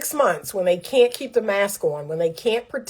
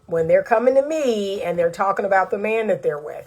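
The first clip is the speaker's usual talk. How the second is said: normal talking voice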